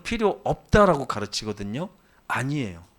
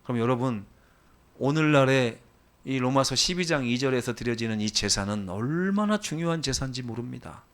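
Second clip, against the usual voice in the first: ordinary delivery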